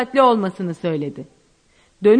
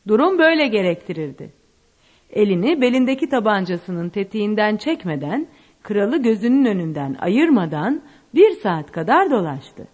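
usual speaking voice

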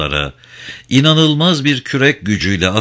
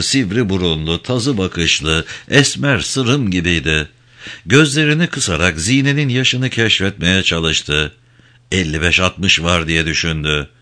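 ordinary delivery

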